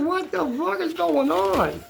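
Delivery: high, slow, drawn-out disbelief; retold bewildered exclamation. Retelling her reaction to distant cries.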